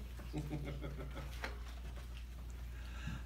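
quiet laugh under the breath; a little amused chuckle; soft breathy laugh. Real, not acted. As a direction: giggle at the silly page. Reader laughs at silly picture.

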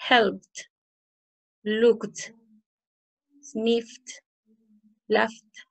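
"helped", "looked", "washed" as one syllable ending in a released "t"; word list with pauses, each "-ed" said as a crisp voiceless t; teacher modelling "helpt", "lookt", "fixt" one at a time. Shown as a demonstration. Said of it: "lo terminas con el sonido".

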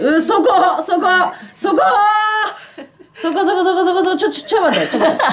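high pained laughing outburst; laughing cry of pain. Real, not acted. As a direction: laugh through a sharp ache, pleading. Massage hits sore spot. (痛がって笑いながら叫ぶ)

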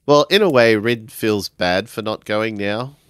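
normal talking voice

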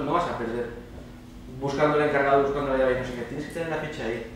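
usual speaking voice

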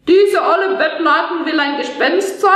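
mocking schoolboy taunt. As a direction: sneer at the ghost like a cheeky boy. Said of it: "hänselte das Gespenst".

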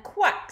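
short, high duck quack. A narrator's reading. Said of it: "said the duck".

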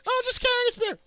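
high, airy mock-innocent fairy voice; smug fairy. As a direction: play it off sweetly like it's nothing. Voicing the fairy again.

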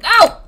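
loud, high "ow"; sudden sharp cry of pain; pained yell. Real, not acted. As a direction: yelp as if just struck. Hit during the game.

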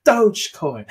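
Dogecoin in a light British accent, first vowel a rounded long oh. Shown as a demonstration. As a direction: say Dogecoin the British way: doh-jcoin. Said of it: "That's British accent".

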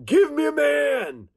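high ringing challenge cry. Acted. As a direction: call out to a whole army. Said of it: "crying out to the Israelite army".

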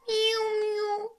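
high meow; a high drawn-out meow; cat meow. Acted. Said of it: "fit le miou".

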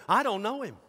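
mildly indignant acted denial; quick denial. Disciple denying Jesus to a little girl, mild protest.

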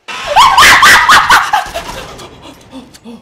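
loud high panicked laugh; shrieking laugh. Real, not acted. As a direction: burst into a shrill laugh.

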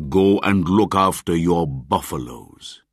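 put-on a gruff bark at the herd boy; angry. A narrator's reading. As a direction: hunter ordering the boy away. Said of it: "he cried angrily".

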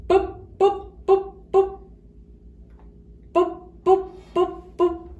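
popping p syllables; sonido p. Shown as a demonstration. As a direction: pop each p crisply, high. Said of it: "repasemos nuestros sonidos". (sílaba pop marcada)

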